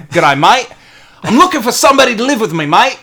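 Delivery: high, loud, hammed-up Australian; improved Australian accent. Co-host retries the fake ad after criticism, broader Australian accent. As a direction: lay the Australian accent on thicker. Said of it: "That's better".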